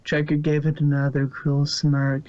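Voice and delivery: ordinary delivery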